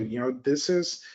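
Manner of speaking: ordinary talk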